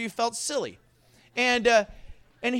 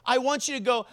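ordinary talk, nothing marked

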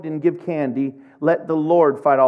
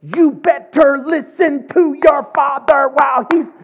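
high, louder scolding threat; stern mom warning; 9 semitones higher, 7 dB louder than usual. Retold or imitated. Pastor imitates mom scolding him during church. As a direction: hiss a warning behind the smile. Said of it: "she was probably saying".

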